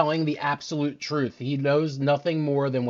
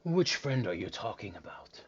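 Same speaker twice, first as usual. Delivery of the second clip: hushed whisper, softer; 4 semitones lower, 8 dB quieter than usual. Acted. Bartender whispering to Aroi. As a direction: whisper it conspiratorially.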